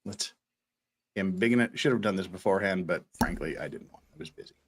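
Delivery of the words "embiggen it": ordinary talk, nothing marked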